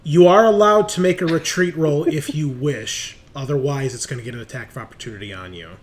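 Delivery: usual speaking voice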